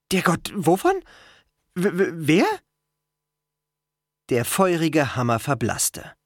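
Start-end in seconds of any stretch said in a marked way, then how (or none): 0.1-2.6 high, stuttering disbelief; baffled stammer. A narrator's reading. Boy learns of his godly parent, stammering bewildered. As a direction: stumble over the question, bewildered.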